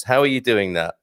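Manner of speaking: ordinary delivery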